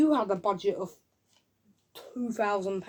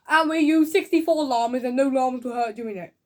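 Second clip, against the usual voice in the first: higher, loud acted notice; mock announcer; 3 semitones higher, 6 dB louder than usual. Reviewer imitating the film's joke credits, loud mock announcement.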